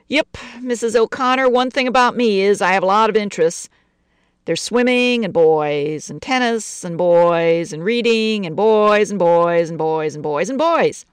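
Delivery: put-on lingering on every boys; gangster's moll. Betty lists interests.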